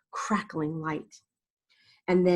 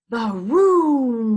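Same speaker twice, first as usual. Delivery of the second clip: booming thunder word; 5 semitones higher, 8 dB louder than usual. A narrator's reading.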